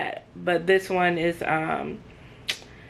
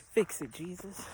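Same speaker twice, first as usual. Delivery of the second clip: ordinary delivery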